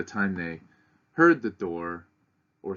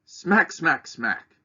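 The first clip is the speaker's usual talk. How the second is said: smack, smack, smack read louder and higher; 8 semitones higher, 6 dB louder, much slower than usual. Shown as a demonstration. Reading the poem aloud to students, louder smacks. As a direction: make each smack land harder. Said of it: "would get louder each stanza".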